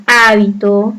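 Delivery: hábito with the stress on HÁ; stress on first syllable. Shown as a demonstration. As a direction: lean on the opening syllable of hábito. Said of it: "he resaltado la palabra".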